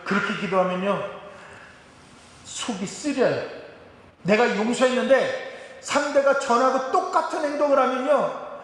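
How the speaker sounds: normal talking voice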